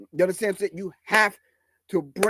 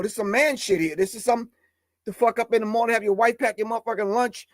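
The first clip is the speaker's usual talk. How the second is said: normal talking voice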